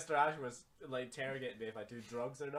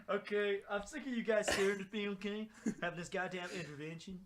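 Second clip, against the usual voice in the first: mock outburst; 11 semitones higher, 6 dB louder than usual. Mock outburst at friends' intervention.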